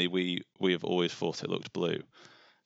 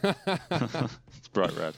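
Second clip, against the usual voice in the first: laughing; 6 semitones higher, much slower than usual. Real, not acted. Joking about blushing.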